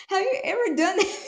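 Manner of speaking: high, amused question through laughter; laughing. Real, not acted. Speaker asks listeners after her own impression, laughing. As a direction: ask it while cracking up.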